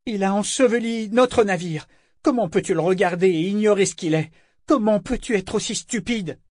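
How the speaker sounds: high-pitched, incredulous reproach; desperate outrage. A narrator's reading. Althea turns wild-eyed on Yémin, outraged. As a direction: plead and accuse at once.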